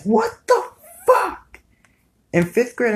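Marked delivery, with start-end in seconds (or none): 0.0-1.6 squeaky high what the fuck; mock shocked squeal. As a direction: squeal it in disbelief. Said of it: "I was like".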